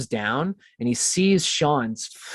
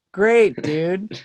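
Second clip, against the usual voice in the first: sarcastic great dude; 7 semitones higher, 4 dB louder than usual. Retold or imitated. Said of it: "And I was like".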